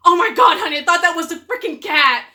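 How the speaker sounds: husband shocked it was a towel; startled surprise. Retold or imitated. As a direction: blurt it out amazed. Husband realizing towel isn't cat.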